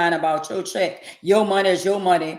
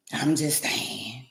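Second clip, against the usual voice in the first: slow, whispered aside; sly whisper; 5 semitones lower, 4 dB quieter, slower than usual. Real, not acted. Whispering her catchphrase to viewers, slow. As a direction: whisper it slowly like a secret.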